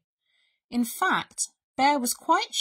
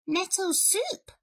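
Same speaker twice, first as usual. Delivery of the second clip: high little mouse voice; high storybook mouse; helpful mouse; 10 semitones higher than usual. A narrator's reading. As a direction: present it proudly as a tiny mouse. Mouse serves soup.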